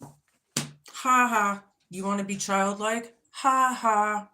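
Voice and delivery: high, loud taunting "ha ha"; mock a bratty kid, sing-song; childlike sass. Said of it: "There's some childlike fucking sass for ya".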